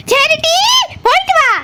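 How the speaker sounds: high, mock-merry goodbye; forced cheerful send-off. Mother told to say goodbye laughing, forced cheer. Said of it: "சிரிச்சிட்டு சொல்லுன்னு சொல்றான்ல".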